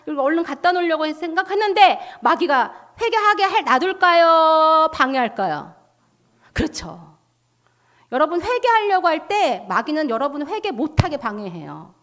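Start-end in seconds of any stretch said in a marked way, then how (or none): 3.6-5.7 drawn-out question. Real, not acted. Rhetorical question to congregation.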